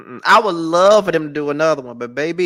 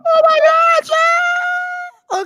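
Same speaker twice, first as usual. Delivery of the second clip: shocked outcry; 3 dB louder, much slower than usual. Real, not acted. Shocked at friend's blunder.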